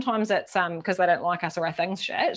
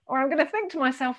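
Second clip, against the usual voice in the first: ordinary talk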